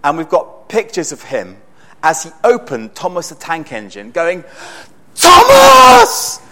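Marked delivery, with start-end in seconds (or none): 5.2-6.4 put-on a little boy shrieking his joy, much higher and louder; ecstatic child's shout. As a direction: yell the name like a two-year-old overjoyed at a present. Toddler opening birthday train set.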